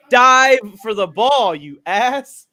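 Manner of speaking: loud, slow scolding; mock scolding yell. Real, not acted. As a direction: bark it at the player. Criticizing a player.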